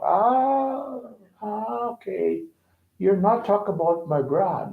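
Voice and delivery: long, high, soft "ah"; imitating a relieved listener getting it; dawning realization. Retelling the lady's dawning understanding.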